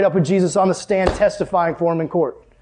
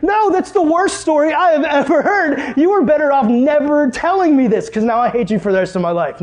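mock outrage; 8 semitones higher than usual. Hammed-up outrage at friend.

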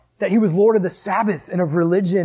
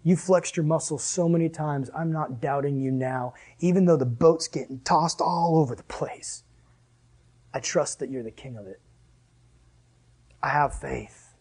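soft, sincere prayer; 3 semitones lower, 5 dB quieter than usual. Retold or imitated. Believer praying to Jesus amid a storm, soft and sincere. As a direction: speak it quietly, with trust. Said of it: "look up to him and go".

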